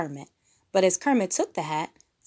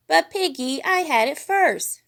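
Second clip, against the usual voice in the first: put-on higher, louder child protest; 9 semitones higher, 7 dB louder than usual. A narrator's reading. Fozzie protesting to Piggy over the hat, higher and louder. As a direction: whine that you had it first. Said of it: "Baby Fozzie protested".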